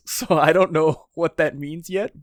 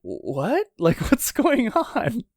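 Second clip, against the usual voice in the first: confused laugh; 9 semitones higher, much slower than usual. Retold or imitated. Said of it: "Yamato's just like".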